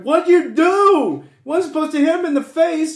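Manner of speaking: high, loud imitation of an angry brother; mock outraged yell. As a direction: yell the accusation, drawing it out. Acts out angry brother confronting him.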